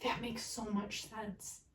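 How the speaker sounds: slow, drawn-out awe sinking to a hush; overwhelmed to tears. Real, not acted. Reader struck by a huge revelation, tearfully awed. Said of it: "I'm in tears right now".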